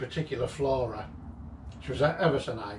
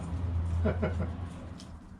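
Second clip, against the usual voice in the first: breathless laugh; 6 semitones higher, 4 dB quieter than usual. Real, not acted. Laughing at the mess.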